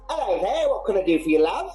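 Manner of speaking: high, bright-pitched Cockney greeting; chirpy Cockney tavern lass; Cockney barmaid greeting a customer. Acted. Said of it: "I lost my Cockney".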